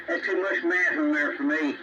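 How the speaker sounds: ordinary talk, nothing marked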